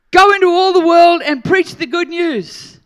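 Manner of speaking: ringing preacher's proclamation; loud proclaimed command. Acted. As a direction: call it out as an order to the whole crowd. Acts out Jesus commissioning the disciples.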